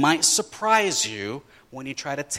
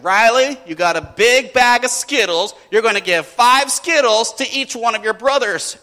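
louder, higher mom laying down the law; imitating a mother giving orders; intervening mom; 6 semitones higher, 8 dB louder than usual. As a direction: firmly instruct a child. Mom intervenes, voiced in story.

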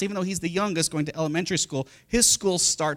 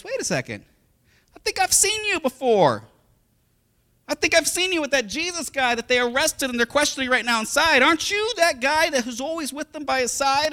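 high, louder accusing voice; accusing servant; 10 semitones higher, 4 dB louder than usual. Acted. Servant at the fire accusing Peter, high and insistent. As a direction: point the finger, sure of it.

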